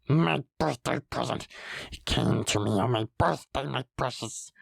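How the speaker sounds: slither the words like a cave creature; wheedling Gollum with gulping gollums. Acted. Gollum croons.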